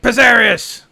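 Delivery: high, loud angry call; aggressive shout. Acted. Rumney angrily shouts his friend's name at the shop door, loud. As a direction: bang out the name. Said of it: "knocks again, like, more aggressively".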